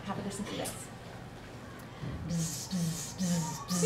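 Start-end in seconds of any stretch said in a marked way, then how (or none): 2.3-3.8 low buzzing bee sound. Shown as a demonstration. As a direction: buzz like a bee, low and steady. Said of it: "Listen to mine".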